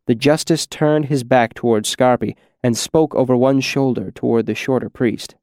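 plain reading voice

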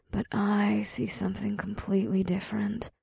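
soft fast whisper. A narrator's reading. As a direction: breathe it out, hushed. Ada's hushed teasing aside.

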